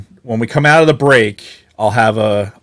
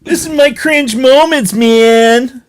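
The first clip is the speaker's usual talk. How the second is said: high, dreamy stoner voice; mellow dreamy hippie; 12 semitones higher, 3 dB louder, slower than usual. Pretend. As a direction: float it out like a laid-back hippie. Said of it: "with the hippie voice".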